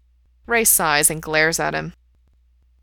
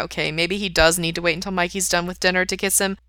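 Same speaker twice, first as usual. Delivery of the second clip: plain reading voice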